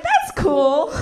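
laughing nervous agreement. Retold or imitated. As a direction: giggle out a nervous okay. Laughing at house invite.

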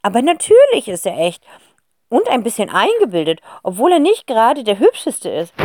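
grand countess. Acted. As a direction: chatter grandly about the scarf. Gräfin spricht lange. (laute, gehobene Gräfinstimme)

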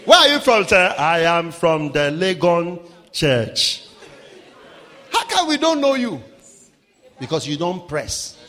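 normal talking voice

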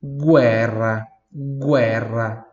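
guerra as "GWEH-rra"; Italian pronunciation. Shown as a demonstration. Teacher models the Italian word, guerra with a clear "gw". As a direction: sound the U and trill the R in guerra. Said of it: "Tá vendo a diferença?".

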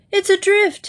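high alarmed cry. A narrator's reading. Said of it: "he cried".

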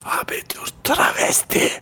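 hushed conspiratorial whisper; breathy secret whisper. Pretend. Whispered joke punchline.

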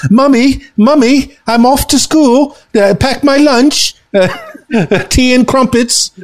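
hammed-up, high-pitched English schoolchild; mock English kid calling to Mummy; English schoolchild accent. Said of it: "I tried to do an English accent".

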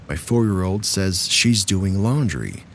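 usual speaking voice

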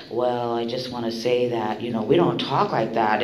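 low, soft, measured imitation; mild reproof. Older member correcting a sweary sharer at the meeting, calm reproof.

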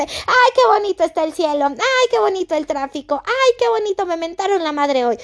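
high, gushing mock delight; sarcastic sing-song rapture; fake cheeriness. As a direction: chirp it through a forced smile. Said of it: "con una pinche sonrisa".

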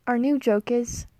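ordinary talk, nothing marked

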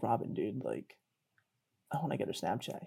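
low, very soft aside to a buddy; hushed confiding. Retold or imitated. Guy confiding a crush to his friend, hushed. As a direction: keep it down so she won't hear.

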